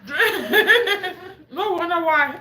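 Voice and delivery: laughing while quoting the lady. Retold or imitated. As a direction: giggle through each item. Lady's list retold, laughing.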